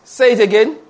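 normal talking voice

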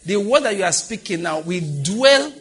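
high-pitched, emphatic exhortation; fervent preaching. Real, not acted. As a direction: press each phrase home to the congregation. Preacher exhorts congregation about their words.

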